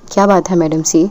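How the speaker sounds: ordinary delivery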